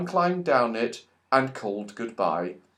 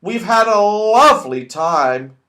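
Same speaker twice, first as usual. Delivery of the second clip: calling out; 7 semitones higher, 8 dB louder than usual. Acted. Calling down from tree.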